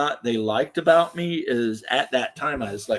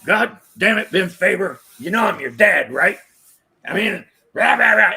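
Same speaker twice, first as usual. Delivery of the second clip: put-on higher, louder gruff old chief ranting; gruff Mac impression; 6 semitones higher, 5 dB louder than usual. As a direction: rant like a salty old fire chief. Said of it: "Can you do your impersonation of Mac again, swearing a lot?".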